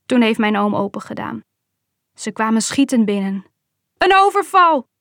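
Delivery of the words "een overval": high, loud cry of alarm; uncle's shrieked robbery alarm; alarmed yell. A narrator's reading. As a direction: yell out a warning to the household. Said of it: "gilde mijn oom".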